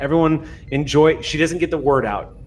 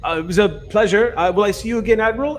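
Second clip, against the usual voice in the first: a hint of a fawning dignitary; High Regent; 6 semitones higher than usual. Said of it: "you see the High Regent go".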